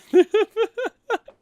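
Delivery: very high giggling shriek; high squeal of laughter. Real, not acted. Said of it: "from laughing this much".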